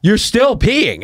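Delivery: high, drawn-out mock disbelief; incredulous cop. Cop to guy still peeing, loud mock disbelief. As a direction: stretch it out loud like an appalled officer. Retold cop's incredulous reply.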